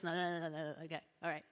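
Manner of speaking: ordinary talk, nothing marked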